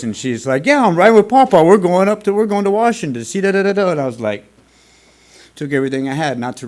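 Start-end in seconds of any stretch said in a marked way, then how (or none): 0.6-3.9 put-on high, loud, excited child's chatter; excited little girl. Dad imitating his young daughter's excited phone call, high and loud. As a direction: bubble over like a thrilled five-year-old. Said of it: "That's all I heard in her little voice".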